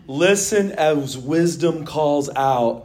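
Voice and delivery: slow, solemn reading of the verse; measured preacher's reading; scripture reading. Acted. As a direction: read it out slowly with weight.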